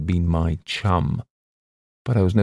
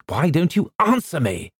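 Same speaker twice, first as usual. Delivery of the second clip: higher, rough angry question; rough demand; 9 semitones higher than usual. A narrator's reading. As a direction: bark the question at him. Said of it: "I said roughly".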